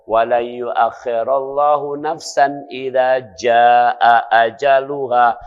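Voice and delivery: slow, chanted recitation of the verse; Quran recitation. Acted. Preacher reciting scripture to the congregation, slow chant. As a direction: draw out the Arabic verse slowly.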